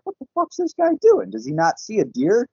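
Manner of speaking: high-pitched retold disbelief; incredulous. Driver watching a car head for the deer, high-pitched incredulity.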